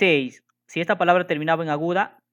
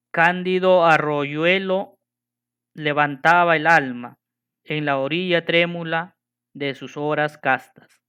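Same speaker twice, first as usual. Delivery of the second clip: poem reading; 4 dB louder than usual. Shown as a demonstration. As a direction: recite the line like a poem. Teacher reads a verse example.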